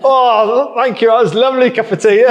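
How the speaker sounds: high, loud hammed-up thanks with laughter; fake gratitude. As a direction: gush over tea you secretly hated. Acts fake thanks for horrible tea.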